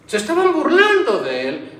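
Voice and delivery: high, loud preacher's outrage; preaching at full force. Acted. Preacher crying out that they mocked God, loud. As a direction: cry it out to the congregation.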